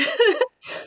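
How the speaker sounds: a high, squealing burst of laughter; squeaky laugh; high giggle. Real, not acted. Breaks into laughter.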